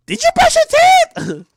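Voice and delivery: high, loud nagging mom voice; mom's question. Retold or imitated. Mom asks her son about his breath, high loud nag. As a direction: nag like a mother. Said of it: "You go on your mom?".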